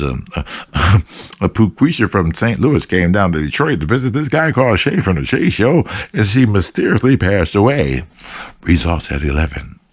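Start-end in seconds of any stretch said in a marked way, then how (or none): 1.4-9.8 spoof news bulletin delivery; mock newsreader. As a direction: read it out like a local anchor. Mock news report of her death.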